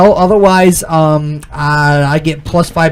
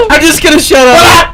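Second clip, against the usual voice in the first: laughing; 11 semitones higher, 4 dB louder than usual. Real, not acted. Laughing wildly mid game.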